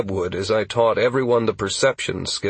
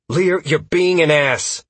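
annoyed; 7 semitones higher, 4 dB louder than usual. A narrator's reading. Angry at Lear.

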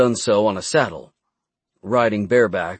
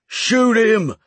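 high, loud shouted order; shouting; 12 semitones higher, 4 dB louder than usual. A narrator's reading. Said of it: "another voice shouted".

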